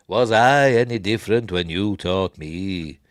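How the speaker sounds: stately quoted mentor, stretched out; mock Obi-Wan. Obi-Wan answering Yoda, slow imitation voice. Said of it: "we also hear the voice of, uh, Obi-Wan".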